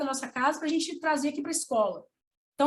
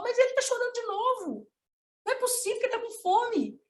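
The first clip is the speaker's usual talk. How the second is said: mãe incrédula; 8 semitones higher, faster than usual. Retold or imitated. Mãe estranhando o choro do bebê.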